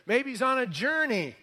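high-pitched mock speculation. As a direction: taunt with a swooping high pitch. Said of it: "Elijah starts to mock these guys".

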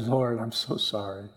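low, soft apology in prayer; remorseful. Retold or imitated. Preacher recalling his apology to the Lord, quiet remorse. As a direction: confess quietly, weighed down with regret. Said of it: "I just sat down and wept".

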